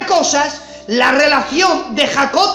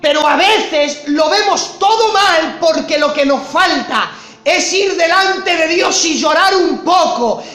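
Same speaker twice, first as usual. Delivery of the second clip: fervent preaching; 4 semitones higher than usual. Acted. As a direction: drive the point home to the congregation. Preaching at full force.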